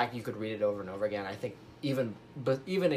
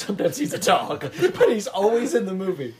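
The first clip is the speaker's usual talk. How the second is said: laughing through the words; giggling while joking; 7 semitones higher, 6 dB louder than usual. Real, not acted. Joking about an actor.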